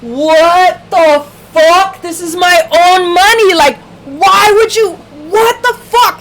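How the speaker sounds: high, loud outraged protest, slow at first. Retold or imitated. To her credit union, a high loud outraged protest. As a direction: spit out the disbelief at the bank. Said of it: "And I was like".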